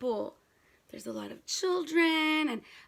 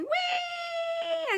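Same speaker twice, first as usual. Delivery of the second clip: high gliding mock "whee"; a long, high, sliding "whee" like a child on a slide; playful whee. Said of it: "Maybe she's going".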